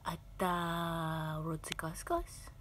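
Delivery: soft breathy whisper. Real, not acted. לחישה שקטה. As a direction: hush it to the pet.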